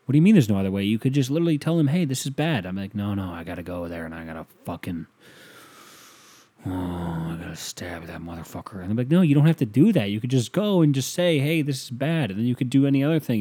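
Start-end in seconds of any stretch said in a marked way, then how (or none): 0.0-2.6 high, swooping mock protest; exasperated objector. Objectors protest in imagined argument. 2.9-8.8 low, hushed, deadpan insistence; grim resolve. Acted. Voices own grim resolve. 9.1-13.4 high, swooping mock protest; exasperated objector. Objectors plead in imagined argument.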